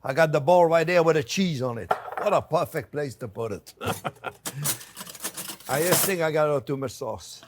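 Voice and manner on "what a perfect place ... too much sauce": ordinary delivery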